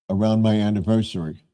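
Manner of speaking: talking through a mouthful; words round a bite of food; mouth full. Real, not acted. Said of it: "I'm talking with my mouth full".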